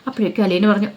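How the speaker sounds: usual speaking voice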